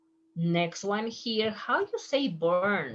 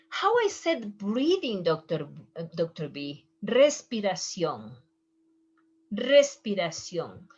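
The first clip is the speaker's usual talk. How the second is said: normal talking voice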